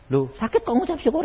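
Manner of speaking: fast, puzzled mock objection; sick member questioning pastor, fast; incredulous sick man. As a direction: object in disbelief. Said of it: "saya bilang".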